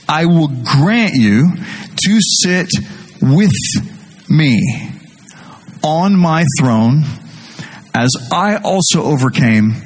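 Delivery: slow, solemn reading of the verse; scripture reading. Acted. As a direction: read the words of Jesus slowly and reverently. Reads scripture slowly, reverently.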